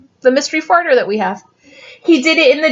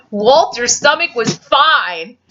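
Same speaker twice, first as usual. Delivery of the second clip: ordinary delivery, nothing marked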